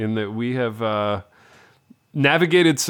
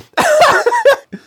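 big loud cackle, high up; loud high burst of laughter; 8 dB louder than usual. Real, not acted.

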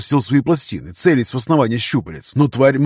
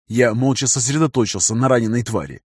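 ordinary delivery, nothing marked

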